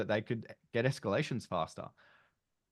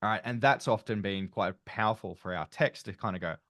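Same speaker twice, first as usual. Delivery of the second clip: normal talking voice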